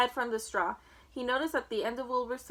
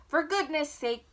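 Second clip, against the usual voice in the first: bellowed; 4 semitones higher, 3 dB louder, slower than usual. A narrator's reading. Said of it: "bellowed Mister Zuckerman".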